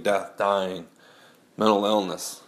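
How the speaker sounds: normal talking voice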